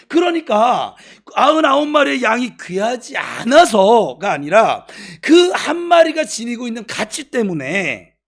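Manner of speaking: loud, high, insistent sermon delivery; impassioned preaching. Real, not acted. Preaches at full force. (높고 힘찬 목소리로 강하게 설교)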